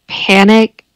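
ordinary delivery

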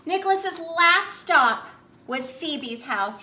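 ordinary delivery, nothing marked

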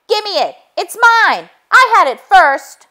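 high, loud whiny pig; squabbling pig. A narrator's reading. Pig grabbing the phone from his brother, high and whiny. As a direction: whine like a greedy piglet. Pigs fighting over phone.

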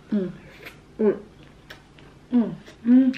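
chewing while humming; muffled closed-mouth murmur; mouth full. Real, not acted. Seen 1: Just put sushi in mouth; closed mouth chewing throughout the mms.

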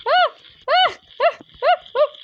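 high, loud short shrieks; startled yelps. Real, not acted. Gamer falling off the stage, high startled yelps. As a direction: yelp in alarm.

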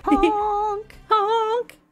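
high goofy honk, half sung; sing-song clown honk; silly clown. Pretend. More honks, laughing.

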